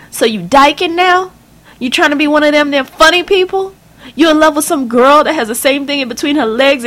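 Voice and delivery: high, loud enraged mother's voice; a scandalised mother tearing into her daughter; furious mother. Acted.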